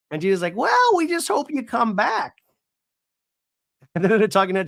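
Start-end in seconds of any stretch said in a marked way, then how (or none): 0.5-2.3 high, slowed-down imitation; mock send-off. Gina sending Katie off, high mock send-off. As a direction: pitch it way up and stretch it out.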